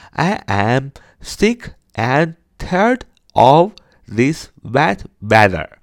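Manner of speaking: very slow, each word set apart. Shown as a demonstration. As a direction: say it slowly with a gap after every word. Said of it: "这是慢慢读". (一个字一个字慢慢地说)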